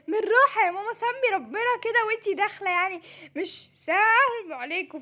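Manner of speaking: ordinary talk, nothing marked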